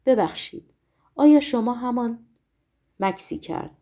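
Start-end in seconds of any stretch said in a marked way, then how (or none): none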